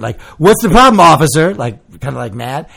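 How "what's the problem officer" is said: higher, louder indignant challenge. Retold or imitated. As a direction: snap it back at the officer. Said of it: "like, indignant".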